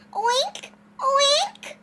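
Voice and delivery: high squeaky oinks, rising; pig oink. A narrator's reading. Happy pig enjoying the car ride, high rising oinks. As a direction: oink twice, pitch jumping way up. Pig's noises voiced in story.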